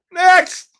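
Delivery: high, loud call of next; shouted next. Real, not acted. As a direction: bark it out to move on. Calling next winner in giveaway.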